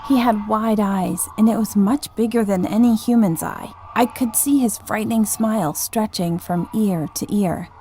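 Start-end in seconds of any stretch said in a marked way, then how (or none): none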